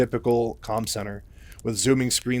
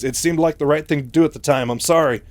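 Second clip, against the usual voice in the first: higher, louder flustered excuse; slight panic; 4 semitones higher, 6 dB louder than usual. Retold or imitated. Mike apologises to Raynor, still slightly panicked. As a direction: defend yourself in a mild mock panic. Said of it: "still panicking slightly".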